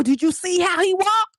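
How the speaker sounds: put-on high, gossipy girlfriend voice; gossiping friend. Friend gossiping about the boyfriend's limp, high and gossipy. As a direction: dish the tea on his walk. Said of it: "she'd be like".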